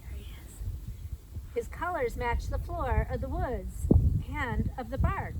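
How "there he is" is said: hushed whisper so the toad won't hop away; whispering to the kids about the toad. Acted. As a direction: breathe it out in a secret whisper. Spotting the toad quietly.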